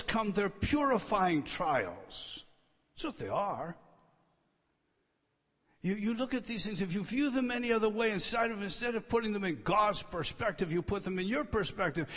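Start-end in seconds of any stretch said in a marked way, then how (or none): none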